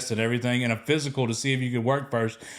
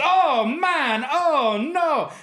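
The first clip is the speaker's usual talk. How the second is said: a high, louder hammed-up Ghanaian wail; mock panic; 13 semitones higher, 4 dB louder, much slower than usual. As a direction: freak out in a Ghanaian accent. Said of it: "going apeshit".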